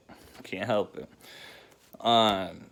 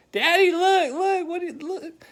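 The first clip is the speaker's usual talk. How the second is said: put-on high, excited toddler voice much higher than his own; little girl voice; 7 dB louder than usual. Dad imitating his toddler daughter showing off a dead chipmunk, high and excited. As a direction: squeak it out like a proud two-year-old.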